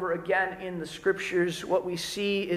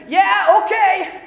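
put-on higher, louder, careless teen yell; lazy kid; 9 semitones higher, 10 dB louder, slower than usual. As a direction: brush her off from the couch. Kid brushing off mom again.

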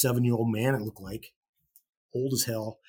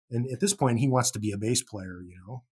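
plain reading voice

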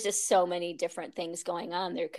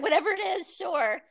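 high, giggly shrug of a reply; laughing along; 11 semitones higher than usual. Retold or imitated. Recalling her own carefree yes to yoga, laughing. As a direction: laugh through a breezy "sure".

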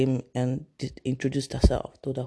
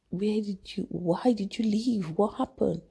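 worried mother; 6 semitones higher than usual. Retold or imitated. Mother asking why she left.

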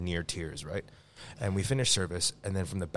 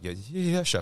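nervous yes-man; 5 semitones higher than usual. Retold or imitated. Frozen dishwasher answers the scary chef.

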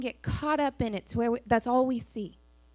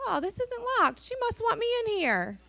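put-on higher, innocent little kid; child's voice; 9 semitones higher than usual. Pastor mimics a kid walking into the bathroom, higher. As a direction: play a kid who finds the bathroom unlocked. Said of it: "They said".

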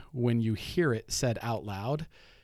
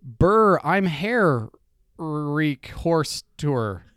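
loud, slow, syllable by syllable, higher; slow sounding out; 3 semitones higher, 8 dB louder, much slower than usual. Acted. Host sounding out a game card for his wife, loud and slow. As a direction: read each chunk out slowly and loudly like a puzzle.

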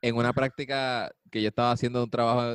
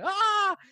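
mocking laugh cry; 4 dB louder than usual. Imitating boss's mocking laugh.

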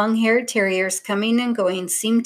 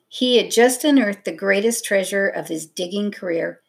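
usual speaking voice

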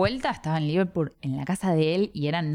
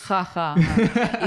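mock laugh; much slower than usual. Risa fingida tras la broma.

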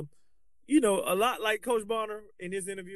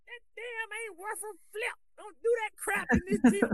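high-pitched coach impersonation; old coach's voice; 14 semitones higher than usual. Acted. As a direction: scold a player in a squeaky put-on voice. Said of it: "that's exactly how he sounded".

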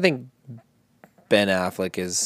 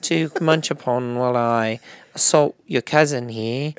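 lightly put-on British accent, a bit high; hint of a posh British accent; mock British accent; 6 semitones higher than usual. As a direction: do a cheeky British bloke, lightly. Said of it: "here's Robert Pattinson".

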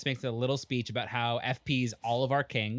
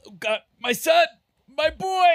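mock sobbing dad; 13 semitones higher, 7 dB louder than usual. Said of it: "He's like".

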